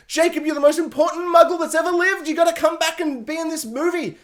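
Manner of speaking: high, insistent parody of a speech; mock pleading character. Reviewer mimicking her urging Jacob back, mock pleading. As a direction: urge him on in a high mocking voice.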